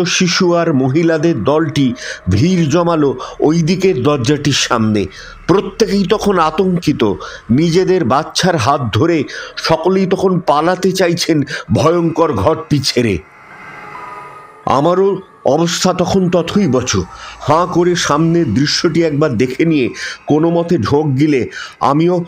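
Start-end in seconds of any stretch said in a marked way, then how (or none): none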